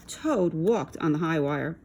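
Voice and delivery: plain reading voice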